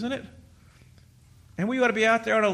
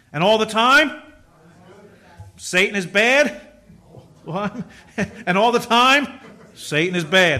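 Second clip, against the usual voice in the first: louder call-out with a laugh; excited refrain; 6 dB louder than usual. Real, not acted. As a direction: punch the refrain, half laughing.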